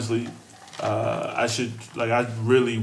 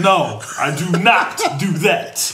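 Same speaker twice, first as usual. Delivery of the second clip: loud, slow, laughing through it; laughing rant; 7 semitones higher, 9 dB louder, slower than usual. Real, not acted. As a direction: crack up while ranting.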